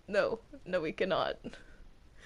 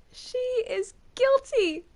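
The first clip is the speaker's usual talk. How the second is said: high, loud cry of guilty; shrill accusing verdict; 12 semitones higher, 5 dB louder than usual. Acted. Judge to the accused, a high loud accusation. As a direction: condemn her like a mad judge.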